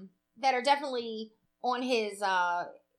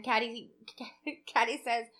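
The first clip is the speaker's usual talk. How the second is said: normal talking voice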